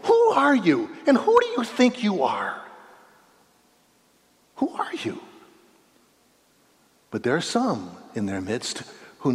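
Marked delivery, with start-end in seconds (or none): 0.0-2.6 high, loud, mocking challenge; religious leaders challenging Jesus, high and loud; skeptical leaders. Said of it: "keep saying to him". 4.6-5.2 whispered, awed question. Retold or imitated. Whispered repeat of leaders' question.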